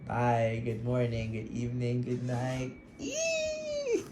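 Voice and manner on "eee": a sudden high-pitched squeal of giddy excitement; squealing like a lovesick fan. Real, not acted. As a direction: let out a real, giddy little shriek. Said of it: "Sorry, I'm really giddy right now".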